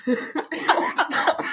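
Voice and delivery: It won't stop stretched very slow through laughter; laughing. Real, not acted. Sister still burning, moaning It won't stop while laughing.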